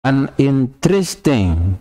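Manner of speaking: "an interesting" slow, n linked to vowel; linking an. Shown as a demonstration. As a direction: join "an" to "interesting". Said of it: "karena ini memang hurufnya huruf vokal". ("an interesting" pelan, n disambung)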